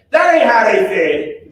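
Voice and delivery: put-on high, loud, laughing old-lady scold; scolding great-grandmother. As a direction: snap at the child like his great-grandmother, half laughing. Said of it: "she'd say".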